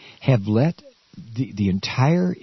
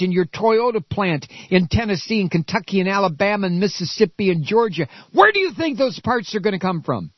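angry tirade; 6 semitones higher than usual. Real, not acted. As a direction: hurl the questions at them, voice up high. Railing at Republican senators.

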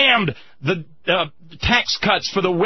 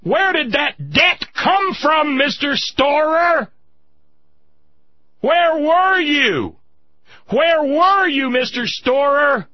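anguished shout; 4 semitones higher, slower than usual. Real, not acted. Host crying out at the veteran, anguished and angry. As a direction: plead in fury.

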